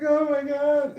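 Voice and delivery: high, loud exasperated cry. Retold or imitated. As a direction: throw up your hands in disbelief. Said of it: "And I'm like".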